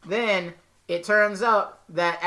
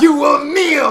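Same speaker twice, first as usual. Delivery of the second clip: louder, higher villain command; shouting Loki demand; dark lord; 7 semitones higher, 10 dB louder than usual. Retold or imitated. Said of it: "then he's like".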